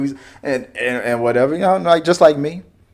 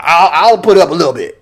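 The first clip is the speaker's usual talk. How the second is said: louder concession; 6 dB louder than usual. Pretend. His imagined reply to the passenger.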